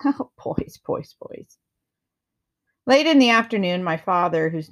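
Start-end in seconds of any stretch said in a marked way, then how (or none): 0.0-1.6 laughing. A narrator's reading. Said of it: "She laughed a lot and said".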